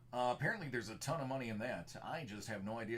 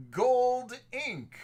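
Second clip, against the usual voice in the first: loud, high shout at the hit; excited shout; 12 semitones higher, 12 dB louder than usual. Real, not acted. Big hit in card break.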